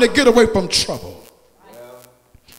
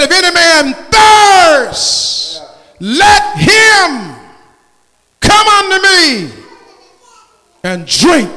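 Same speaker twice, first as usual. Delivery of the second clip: slow, high, shouted call, thirst held long; loud proclamation; 8 semitones higher, 6 dB louder, slower than usual. Shown as a demonstration. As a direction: cry it out across the church. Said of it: "he wasn't whispering".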